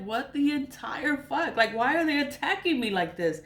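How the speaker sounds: high-pitched, baffled protest; exasperated disbelief. Retold or imitated. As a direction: demand an answer in high-pitched bewilderment. Retells her bewildered reaction to attacks.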